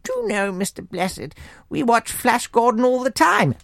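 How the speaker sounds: prim monarch making small talk; the Queen. Acted. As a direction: confide it politely in a regal voice. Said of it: "the Queen continued".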